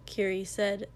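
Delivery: ordinary delivery, nothing marked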